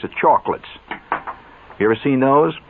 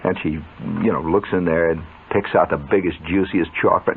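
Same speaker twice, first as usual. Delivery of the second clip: usual speaking voice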